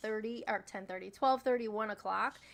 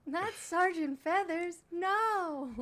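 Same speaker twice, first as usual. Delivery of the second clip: mock anguish; 7 semitones higher, 4 dB louder, much slower than usual. Mock anguish over peacock.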